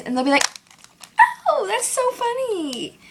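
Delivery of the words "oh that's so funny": high, drawn-out mock gushing; mock amused friend. Friends grabbing her phone to look, high mock amusement. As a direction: imitate an over-amused friend, slow and high.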